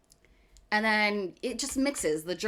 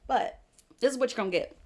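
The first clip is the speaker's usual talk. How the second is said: usual speaking voice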